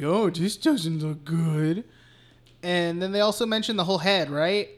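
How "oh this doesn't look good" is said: slow, put-on character voice; mock dismay. Mike arriving at the crime scene, mock dismay. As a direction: draw it out in mock concern.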